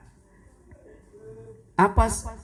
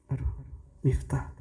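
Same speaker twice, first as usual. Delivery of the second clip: usual speaking voice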